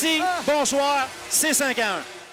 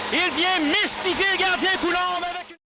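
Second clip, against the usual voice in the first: ordinary talk, nothing marked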